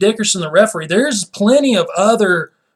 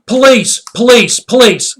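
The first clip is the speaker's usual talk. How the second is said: loud, raised-pitch mimic of an overreacting referee; hammed-up cry for help; mock alarmed call; 5 semitones higher, 8 dB louder than usual. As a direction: call out as if reporting a crime. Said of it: "Like I just shot somebody".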